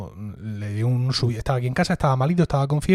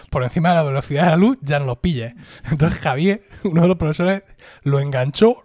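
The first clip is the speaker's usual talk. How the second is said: ordinary talk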